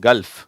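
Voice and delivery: firm falling கல்ஃப், keeping the l audible; L and F joined. Shown as a demonstration. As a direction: model கல்ஃப் with l flowing into f. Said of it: "ஃப் ரெண்டும் சேர்ந்து வருது".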